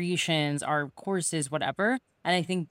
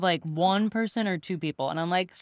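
ordinary delivery

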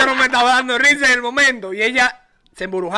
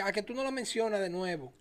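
softly voiced challenge from the girlfriend; quiet dare; 2 semitones lower, 15 dB quieter than usual. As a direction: slip the dare in under your breath. Acted retort of the woman on lap.

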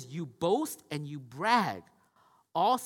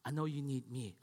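gentle God; 5 semitones lower, 8 dB quieter than usual. Acted. Voicing God tenderly.

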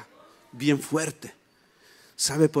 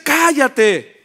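acted loud rebuke, pitched up; scolding; 6 semitones higher, 8 dB louder than usual. Religious crowd telling the blind man to shut up, scolding. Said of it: "me regañaban".

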